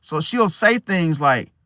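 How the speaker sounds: ordinary delivery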